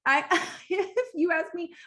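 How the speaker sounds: breaking into a laugh mid-phrase. Real, not acted. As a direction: giggle as you start the line. Laughing through a restart.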